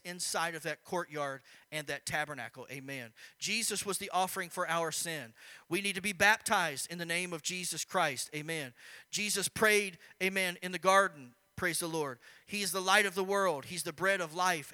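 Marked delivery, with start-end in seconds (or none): 3.4-14.7 loud, ringing preacher declarations; preaching. Acted. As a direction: proclaim it to the congregation.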